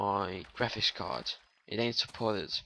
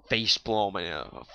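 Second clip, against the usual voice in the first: usual speaking voice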